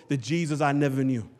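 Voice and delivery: ordinary talk, nothing marked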